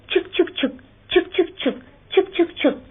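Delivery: high voiced chug-chug; train chugging. A narrator's reading.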